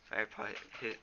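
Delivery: ordinary delivery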